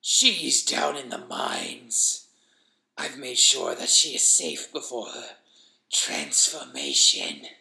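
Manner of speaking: labor over every word, slowly; wheezing sinister creature. Acted. Creature speaks in the story.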